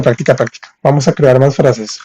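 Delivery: normal talking voice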